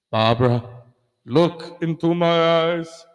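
put-on slow, seductive Spanish accent; Castro accent. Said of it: "'cause Castro was like".

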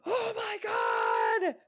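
high, startled exclamation; shocked cry. Real, not acted. Reacting as the creature grabs him, shocked.

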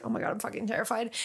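quick, hushed retelling of panic; retold terror. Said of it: "I was terrified the whole time".

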